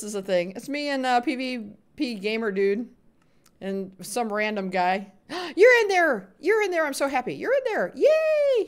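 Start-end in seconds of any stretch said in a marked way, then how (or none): 5.6-8.6 high, loud delighted cheer; excited. Real, not acted. Streamer cheering a viewer who joined the game. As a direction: squeal with joy he made it.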